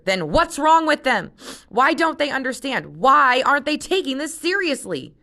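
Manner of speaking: high, loud exasperated demands; exasperated outburst. Acted. Jen points at the computer, loud and exasperated. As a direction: hurl the questions in frustration.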